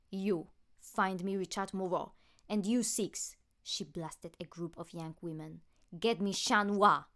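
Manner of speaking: loud commanding villain voice; villain orders. Acted. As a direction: order the hypnotized crowd about. Villain commands hypnotized victims.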